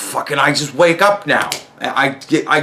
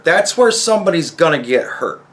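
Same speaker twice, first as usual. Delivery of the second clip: ordinary talk, nothing marked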